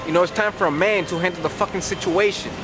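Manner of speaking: usual speaking voice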